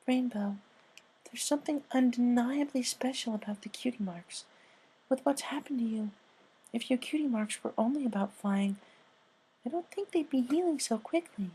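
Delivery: high, soft, timid pony voice; high soft shy pony; Fluttershy voice. Acted. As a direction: speak like a shy gentle mare.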